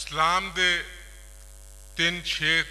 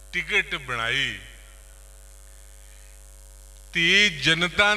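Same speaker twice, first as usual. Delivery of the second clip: raised recital with drawn-out opening words; poetry recitation; 3 semitones higher, 7 dB louder than usual. Acted. Poet reciting the last boli, declaimed. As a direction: stretch the first phrase, then let the close fall.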